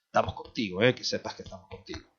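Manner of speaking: a lightly voiced, hushed offhand reassurance; mock pat on the back. Preacher imitating a shallow comforter, mildly mocking. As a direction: toss it off like an empty pat. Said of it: "Es el de la palmadita".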